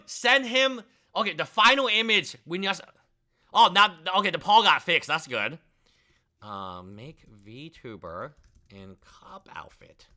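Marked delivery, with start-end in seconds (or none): 6.4-10.1 low, slow, half-voiced reading of what he types; mumbled prompt. Real, not acted. Streamer murmuring an image prompt slowly as he types it.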